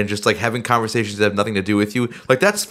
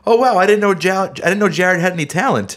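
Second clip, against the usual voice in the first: mock fan comment; 8 semitones higher than usual. Quoting viewer feedback with mock surprise. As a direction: read it like a gushing fan. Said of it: "feedback I got from the Bell video was like".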